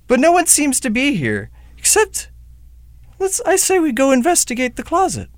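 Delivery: high, wondering stagey delivery; theatrical host. Acted. Host proposes exploring the empty room, high-pitched stagey wonder. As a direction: play the puzzled explorer.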